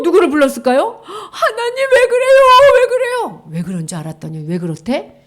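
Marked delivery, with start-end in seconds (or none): 1.0-3.4 high, tearful plea to God; crying plea. Acted. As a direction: cry out to God.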